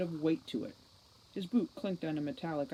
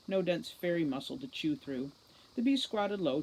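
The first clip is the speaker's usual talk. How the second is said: ordinary delivery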